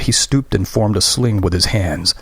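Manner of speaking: plain reading voice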